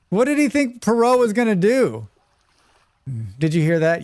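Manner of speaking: ordinary delivery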